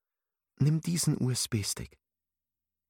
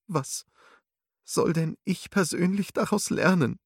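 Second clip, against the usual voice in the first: weeping; 7 semitones higher than usual. A narrator's reading. Alice asking Lyria through tears, weeping. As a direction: ask through tears, voice lifted high. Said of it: "fragte weinend Alice".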